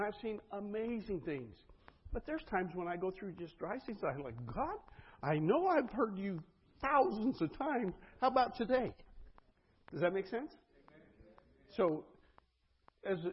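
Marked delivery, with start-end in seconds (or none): 4.5-8.9 high, loud, exasperated plea to God; acted pleading prayer. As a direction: plead upward, stretching it out in mock frustration. Acted plea during dry seasons.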